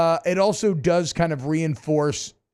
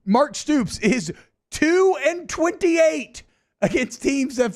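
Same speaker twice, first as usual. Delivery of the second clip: high-pitched, drawn-out stat reveal; mock grand announcement; 9 semitones higher, slower than usual. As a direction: proclaim it like a big on-air reveal, slowly. Theatrically announces the stat on air.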